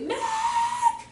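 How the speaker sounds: very high, drawn-out call; imitated child's cry. Boy calling to the teacher who walks in, imitated high cry. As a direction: squeal the name out long.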